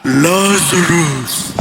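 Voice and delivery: very low, very slow "Lazarus." stretched out; archangel's call. Retold or imitated. Archangel calling from the heavens, deep slow call. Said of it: "In the heavenlies, parang".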